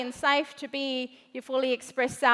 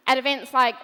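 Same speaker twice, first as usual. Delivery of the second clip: ordinary talk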